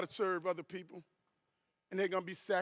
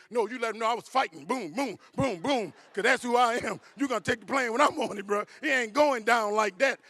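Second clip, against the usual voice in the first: loud, raised comic bravado; fired-up defiance; 3 semitones higher, 8 dB louder than usual. Acted. Husband telling his wife he'd fight the hijackers, fired-up defiance. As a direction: punch it out like a fighter refusing to go down.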